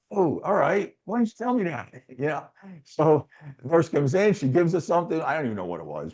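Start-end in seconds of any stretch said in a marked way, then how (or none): 0.1-1.9 higher sudden realization. Retold or imitated. To Lenny, catching on to his trick, higher sudden realization. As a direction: pitch jumping up as it dawns on him.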